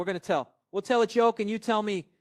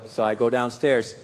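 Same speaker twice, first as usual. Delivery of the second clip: ordinary talk, nothing marked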